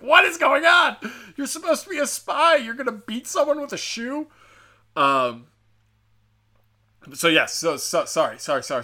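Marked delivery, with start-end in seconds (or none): 0.0-4.3 much higher, loud mock outrage; hammed-up shrieking viewer; exasperated. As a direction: shriek it in disbelief at the screen. Viewer outraged at shoe plan.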